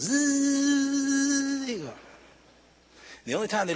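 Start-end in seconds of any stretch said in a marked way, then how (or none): 0.0-1.7 high electric whirr; long, high mechanical drone; motorized chair buzz. Retold or imitated. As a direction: imitate the chair driving off. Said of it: "And then he goes".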